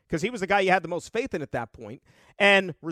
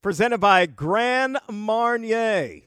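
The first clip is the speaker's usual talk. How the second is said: announcer voice; 4 semitones higher, 6 dB louder than usual. Acted. Hyped intro of weather segment.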